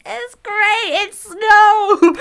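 high, loud gleeful yell; child shouting with joy at first snow; excited shout. Retold or imitated. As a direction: shout it like a kid thrilled by snow. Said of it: "And I'm just like".

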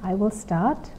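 put-on soft, patient classroom voice to a small child; gentle teacher voice. Shown as a demonstration. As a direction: guide the child calmly through the lesson. Teacher's demo lesson with child.

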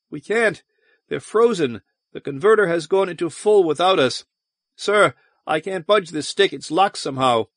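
high-pitched, urgent alarm; panicked report. A narrator's reading. Crew report frozen controls to superior, panicked.

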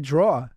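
"draw" for drawer, ending on the vowel; dropped-r pronunciation. Retold or imitated. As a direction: say "draw" without any r. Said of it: "'cause I said draw".

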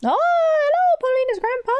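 very high, sing-song hello; squeaky greeting. Acted. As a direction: chirp it way up high. Greeting the grandpa character.

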